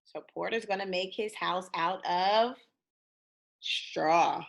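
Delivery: usual speaking voice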